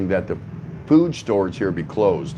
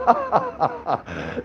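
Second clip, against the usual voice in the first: high laugh; 14 semitones higher than usual. Real, not acted. Laughing at hula hoop man.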